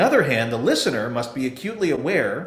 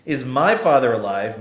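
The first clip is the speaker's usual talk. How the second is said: "my" in "Is my father alive?" held longer and a bit louder; "my" said weighty, "father alive" lighter; stress on my; 3 dB louder than usual. Shown as a demonstration. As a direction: press on "my" to claim him. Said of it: "Meaning that he's my father and not your father".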